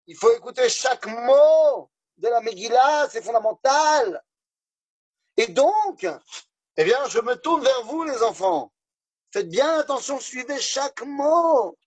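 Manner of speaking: preaching rabbi. Acted. Rabbi addresses the congregation.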